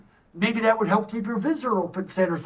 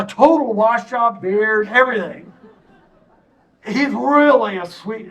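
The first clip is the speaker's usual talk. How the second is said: ordinary talk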